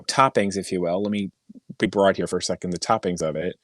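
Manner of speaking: normal talking voice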